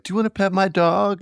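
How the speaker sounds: high, sweet dog-owner offer; chirpy neighbour offering his dog; friendly Brooklynite. Retold or imitated. Brooklyn local offering his dog to a stranger, high and friendly. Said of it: "and they're like".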